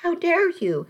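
rising high, furious disbelief; sharp, high-pitched fury. A narrator's reading. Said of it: "I was furious".

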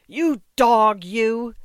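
loud, high enraged snarl; furious outburst. A narrator's reading. Old father attacking the ticket collector, furious. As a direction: spit it at him in rage. Mendel lunges at Ginsberg's throat.

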